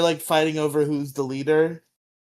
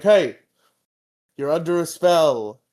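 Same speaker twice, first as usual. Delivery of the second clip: loud mock command, higher; hammed-up order to stop; mock yell; 4 semitones higher, 8 dB louder, slower than usual. Zordon shouting at the fighting rangers, loud mock yell.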